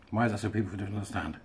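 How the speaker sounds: a fast, exasperated aside under the breath. Real, not acted.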